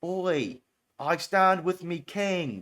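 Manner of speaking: slow, mock cockney swagger; British punk parody, drawn out. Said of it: "Now they would say".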